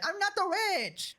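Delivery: high-pitched mock whine of denial; mocking celebrity protest. Commentator mimicking the celebrity denying her riches, mockingly.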